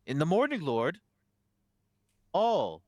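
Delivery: high, swelling religious proclamation; preaching abbot. Acted. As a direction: draw it out as a sermon's climax. Abbot preaches to those in cells.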